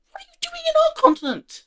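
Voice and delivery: mock indignant question that cracks up high; voice cracking. Portugal confronting the Germans, indignant, voice cracking high. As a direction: play the outraged colony, voice breaking upward. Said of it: "Awful voice crack".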